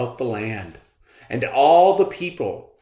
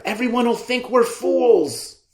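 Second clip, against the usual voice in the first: anxious father; 12 semitones higher, 4 dB louder than usual. Acted. Father scolds child.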